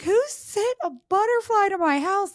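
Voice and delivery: high, loud startled cry; freaking out. Retold or imitated. Retelling finding a butterfly lamp at her door, freaking out. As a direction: shriek it like a shocked homeowner. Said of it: "freaking out".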